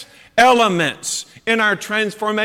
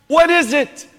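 a touch of baffled Pilate, higher and louder; dumbfounded Pilate; 6 semitones higher, 4 dB louder than usual. Retold or imitated. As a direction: lightly act out Pilate staring blankly at the truth. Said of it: "who is dumbfounded".

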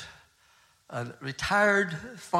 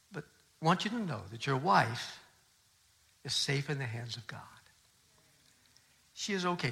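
softer, hushed reassurance; gentle comfort; 3 semitones lower, 7 dB quieter than usual. Retold or imitated. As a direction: speak low and tender to a weeping man. Pastor comforting grieving widower.

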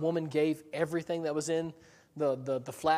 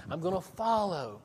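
slightly raised, acted eagerness; eager promise; 4 semitones higher than usual. Peter promises he will follow, eagerly. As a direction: pledge it keenly, as a quoted disciple.

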